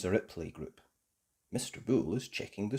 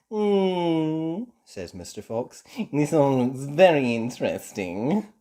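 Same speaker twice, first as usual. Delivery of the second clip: sly fox voice; 5 semitones higher, 6 dB louder, slower than usual. A narrator's reading. Mister Fox browsing.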